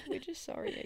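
giggling through the words; laughing it out, high and light. Real, not acted. Giggly mock apology to listeners.

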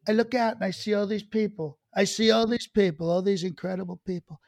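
imitated president's rambling speech; put-on presidential speech voice; Trump. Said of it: "at the C I A and he says".

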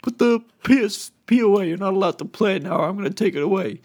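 quick, high scolding impersonation; imitating a co-counselor. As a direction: tell a kid off in a high mimicked voice. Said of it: "he talked like this".

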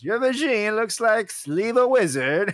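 slow, high Kazakh-style Borat voice; Borat accent. Retold or imitated. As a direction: quote it in a hammy Borat accent. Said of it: "Borat ... good lines".

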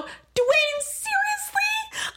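very high, stretched-out disbelief; incredulous protest. Retold or imitated. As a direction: squeal it in exaggerated disbelief. Acting her own incredulous protest.

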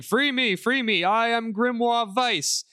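high, drawn-out commanding book voice; yelling book voice. Acted. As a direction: call out grandly, slowly. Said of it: "the book starts yelling at him".

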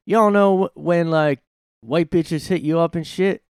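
loud, high-pitched put-on stand-up voice; switched-up, exaggerated street-comic delivery; Richard Pryor. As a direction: punch it out as a brash imitation of a comic. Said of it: "He'd try to sound like a Pryor".

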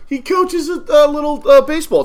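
loud, high, insistent talking over others. Real, not acted. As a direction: push through the interruption at high pitch.

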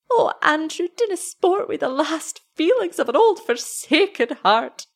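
high, plaintive Scots woman; pleading old wife. A narrator's reading. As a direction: beg not to be teased. Wife pleads not to be mocked.

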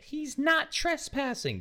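very high, slow imitation outcry; mock protest. Girl to the people yelling at a man, mock protest.

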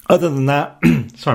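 hoarse, losing voice. Real, not acted. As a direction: voice going hoarse and scratchy. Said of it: "I'm losing my voice here a little bit".

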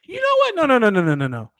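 high, loud indignant protest; indignant outburst. Real, not acted. Reigning champ pushing back against mocking friends, indignant. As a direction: puff up and refuse it. Champ boasting after being mocked.

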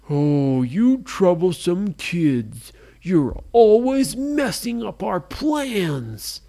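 loud, slow villain grumble; grumpy pirate. A narrator's reading. Pirates scolding the troublesome kids, loud and slow. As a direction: growl it like an annoyed pirate.

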